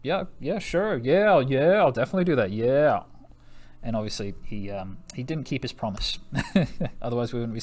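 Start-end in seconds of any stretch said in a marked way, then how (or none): 0.0-3.0 high, mocking yeah-yeah brush-off, a little louder; dismissive Hitler. Teacher mocks Hitler brushing off France and Britain, sarcastic and dismissive. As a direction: toss off empty promises like a smirking bully. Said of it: "And Hitler was like".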